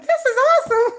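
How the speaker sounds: high, loud delighted squeal; excited squeal. Retold or imitated. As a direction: squeal with glee. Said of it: "I'm like".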